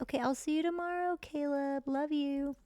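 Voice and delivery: high-pitched put-on goodbye; high imitated character voice; acted character quote. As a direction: voice her farewell well above your own pitch. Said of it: "And she'll be like".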